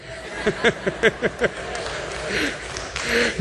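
long breathy chuckle; wheezy, quiet laughing fit; laughter. Real, not acted. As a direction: laugh it out softly after the joke. Preacher laughs at own remark.

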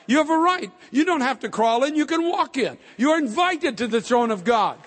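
rising, ringing pulpit preaching; impassioned sermon build; fervent preaching. Acted. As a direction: lift the crowd, voice climbing high. Preaching at full force.

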